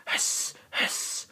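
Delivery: soft unvoiced double hiss; snake hiss. Snake chasing the president round the room, snake hiss.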